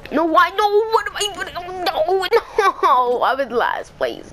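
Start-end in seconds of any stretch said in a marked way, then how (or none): 0.1-1.5 high, fast panicked cries. Real, not acted. Falling to last place. 1.6-3.2 high laugh of disbelief; laughing despair. Real, not acted. Laugh heard.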